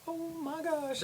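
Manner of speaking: very high, soft mock dismay; tiny stunned squeak. Reacting to a stranger drumming on a pole, tiny squeak. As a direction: squeak it quietly under your breath.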